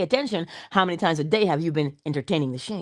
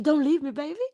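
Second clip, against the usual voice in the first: pleading; 7 semitones higher than usual. Acted. Old habit pleads.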